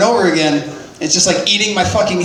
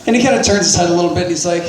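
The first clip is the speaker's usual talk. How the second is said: normal talking voice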